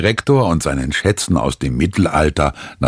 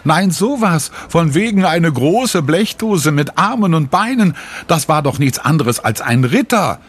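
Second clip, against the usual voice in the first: put-on high child's exclamation; a girl amused at her friend's words, pitched high; excited child's exclamation, voiced higher; girl calling out; 9 semitones higher than usual. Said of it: "lacht sie und ruft".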